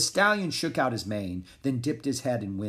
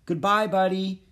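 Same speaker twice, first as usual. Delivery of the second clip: high, raised farewell call; calling goodbye; 8 semitones higher, 5 dB louder than usual. A narrator's reading. Jack watching the horse disappear, high loud goodbye. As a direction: call it out to someone far off.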